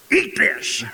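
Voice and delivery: high-pitched, forceful order; commanding lion. Acted. Lion forces gazelle meat on the cub, commanding. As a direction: push it out as a sharp demand.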